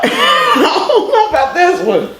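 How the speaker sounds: laughing, voice pitched high; giggling through the words. Real, not acted. Laughing at the hot sauce.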